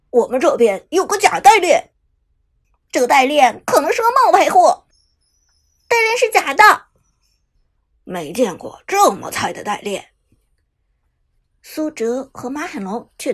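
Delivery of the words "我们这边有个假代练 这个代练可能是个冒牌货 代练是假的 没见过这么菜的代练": loud, jeering gamer taunts; mocking trash talk. A narrator's reading. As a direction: shout the insults at the whole lobby. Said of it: "敌方对手全屏开喷". (大声嘲讽开喷)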